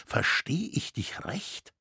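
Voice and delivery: slow stunned whisper; hushed, unvoiced question. A narrator's reading. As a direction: breathe it out in shocked disbelief. Shaken friend asks in disbelief.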